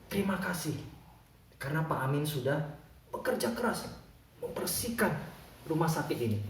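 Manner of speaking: soft, gently paused gratitude; hushed, earnest acted gratitude; sincere thanks. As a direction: thank him quietly, phrase by phrase. Heartfelt thanks to the janitor.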